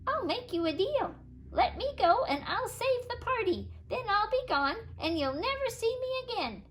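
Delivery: high little leprechaun voice bargaining; pleading leprechaun. A narrator's reading. Leprechaun bargaining with Sidney, high and wheedling.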